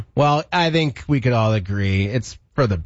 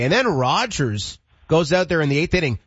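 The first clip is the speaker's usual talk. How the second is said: ordinary talk, nothing marked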